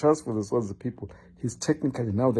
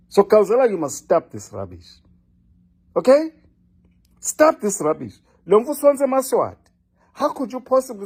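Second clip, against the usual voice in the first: scolding; 8 semitones higher than usual. Real, not acted. Scolding Gauzela directly.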